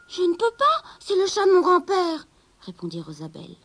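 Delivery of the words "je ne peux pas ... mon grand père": high, louder refusal; young girl. Acted. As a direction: protect grandfather's cat. Rosabelle refuse. (jeune fille qui refuse, voix aiguë)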